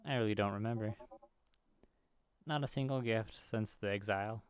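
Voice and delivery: ordinary talk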